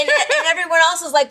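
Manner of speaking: laughing. Real, not acted. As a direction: talk while cracking up. Host laughing mid-sentence.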